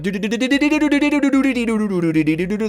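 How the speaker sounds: high stuttered "do-do-do" beeps; rapid Morse-code beeping. Pretend. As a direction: imitate a telegraph with rising, then falling doo-doos. Voices a Morse code name.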